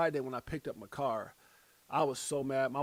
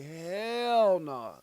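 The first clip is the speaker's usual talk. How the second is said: long drawn-out, higher "hell no"; retold emphatic refusal; 4 semitones higher, 4 dB louder than usual. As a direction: stretch the refusal out in comic disgust. Retold refusal to wife's remark.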